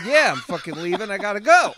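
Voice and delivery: mock fed-up comeback, punched out; retold snap. Narrator telling the drunk guy he's leaving, loud and irritated. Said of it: "And I said".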